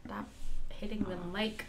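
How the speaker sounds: whispered complaint. Real, not acted. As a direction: whisper it, annoyed. Annoyed, half-asleep co-host.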